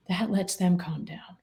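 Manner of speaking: soft unvoiced whisper. Telling the host how calm works, whispering. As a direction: breathe it out gently.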